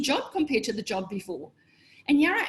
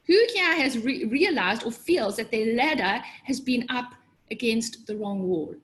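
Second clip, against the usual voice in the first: normal talking voice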